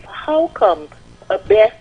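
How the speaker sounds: normal talking voice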